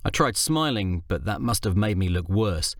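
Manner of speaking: usual speaking voice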